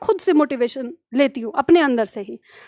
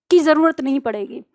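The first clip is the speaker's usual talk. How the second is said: usual speaking voice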